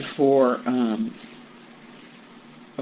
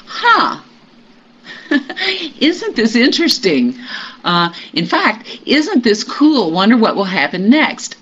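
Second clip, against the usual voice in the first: curious delight; 7 semitones higher than usual. Retold or imitated. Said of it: "there's a part of me that goes".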